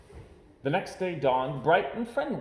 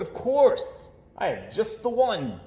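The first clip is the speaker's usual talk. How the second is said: a hint of eager papa; 8 semitones higher, 4 dB louder, slower than usual. Papa offers a story.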